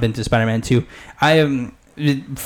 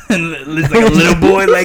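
laughing; 5 semitones higher, 5 dB louder than usual. Retold or imitated. Mocking a little boy in costume.

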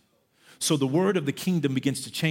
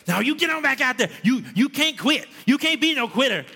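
high, loud cheering parent at a ball game; coaching parent; 13 semitones higher, 5 dB louder, faster than usual. Retold or imitated. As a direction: egg the kid on to bat again. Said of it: "You do it with your kids".

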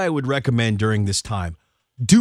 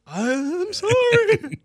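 high, slow drawn-out apology; meek mock apology; much slower than usual. Himself apologizing to the clerk, high and slow. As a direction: squeak it out sheepishly. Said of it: "And I was like".

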